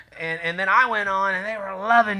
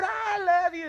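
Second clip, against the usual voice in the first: falsetto repeat; 11 semitones higher, 6 dB quieter, slower than usual. Retold or imitated. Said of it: "She said".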